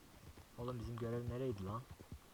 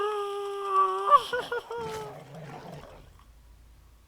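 panicked laugh; 9 dB louder than usual. Real, not acted. Panic during wolf attack.

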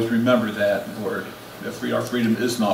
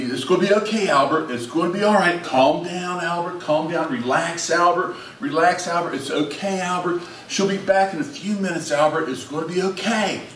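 calm him down gently, like a patient father; soothing the baby; 4 semitones higher than usual. Retold or imitated.

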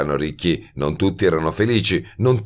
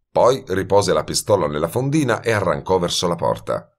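ordinary delivery, nothing marked

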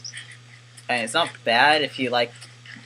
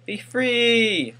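calling out; 10 semitones higher, 3 dB louder than usual. Real, not acted. Releasing an animal, calling out.

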